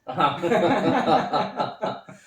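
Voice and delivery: a loud, rising burst of laughter; hearty laugh. Real, not acted. Laughs at guest's joke.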